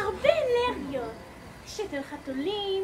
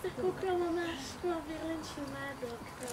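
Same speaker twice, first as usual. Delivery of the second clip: fading away; 3 semitones lower, 7 dB quieter than usual. Acted. Line fades out theatrically.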